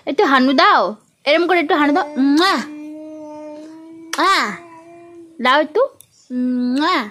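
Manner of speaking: sing-song pleading for a kiss. Real, not acted. Mother asking her baby to wave and give a kiss, sing-song.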